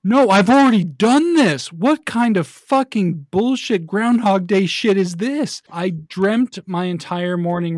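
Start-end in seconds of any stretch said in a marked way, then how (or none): 0.0-5.6 loud, higher, outraged protest; frustrated rant. Retold or imitated. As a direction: rage at waking into a repeat morning. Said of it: "I'm like".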